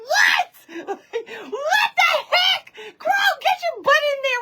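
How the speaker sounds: very high, incredulous squeal, half laughing; mock shocked shriek. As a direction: shriek it like a scolding in disbelief. Retold shock at seeing hen alive.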